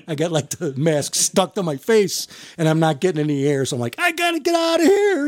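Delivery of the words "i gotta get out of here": high, panicky mock cry. Rookie out of air in the mask, high mock panic. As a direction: squeak it out like your air's gone.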